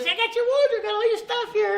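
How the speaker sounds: high, loud mock-perky voice; chirpy put-on gushing; bubbly. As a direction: imitate an over-eager, bubbly assistant. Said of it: "she's just, like, bubbly shit".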